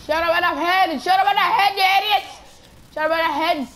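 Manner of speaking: loud, high frantic hollering; high-pitched shouting. Real, not acted. Gamer yelling at idiots in the fight, high frantic shouting. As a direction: yell the same call over and over.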